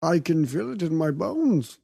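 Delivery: put-on low, dramatic Egyptian accent. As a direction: intone it like a mystical Egyptian. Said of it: "I love your Egyptian accent".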